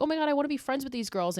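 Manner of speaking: high, fast mock gushing; gushing younger self. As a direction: rush it out like a starstruck fan. Voicing naive younger self.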